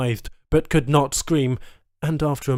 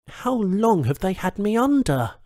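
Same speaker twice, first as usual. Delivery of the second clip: higher, shaken wondering voice; dazed hero; 8 semitones higher than usual. A narrator's reading. Charles asking himself how long he was under, shaken. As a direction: ask it stunned and lost.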